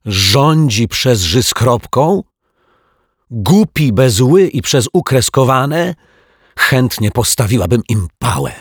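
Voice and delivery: loud, high-pitched outraged teacher; scandalised schoolmistress, loud and emphatic; thundering indignation. A narrator's reading. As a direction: thunder at the spelling mistakes, easing off at the end. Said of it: "zagrzmiała pani Ryczaj".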